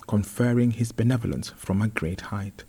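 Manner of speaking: plain reading voice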